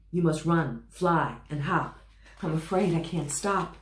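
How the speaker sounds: high, loud, desperate elephant pleading; frantic elephant. Acted. Elephant begging the reader, frantic and loud.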